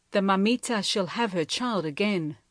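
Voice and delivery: plain reading voice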